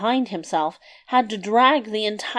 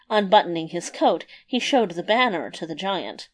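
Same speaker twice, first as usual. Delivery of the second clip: plain reading voice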